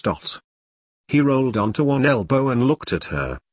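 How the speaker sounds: usual speaking voice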